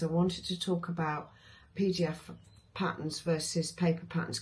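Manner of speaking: usual speaking voice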